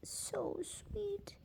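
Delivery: high, soft cooing at the cat. Real, not acted. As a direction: coo it like talking to a kitten.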